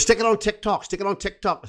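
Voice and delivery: high, insistent put-on Welsh accent; Pontypridd-Merthyr accent. Fan wanting the drinking video sent, in an excitable Pontypridd-Merthyr accent. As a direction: chant the demand in high-pitched Pontypridd. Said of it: "I'll do Miss Pontypridd, uh, slash Merthyr".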